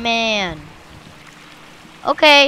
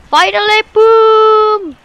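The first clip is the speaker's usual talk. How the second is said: high, loud, stretched boom; excited sound-effect exclamation; triumphant strike; 6 semitones higher, 5 dB louder than usual. Real, not acted. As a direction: shout the hit with glee. Triumphant hit in game.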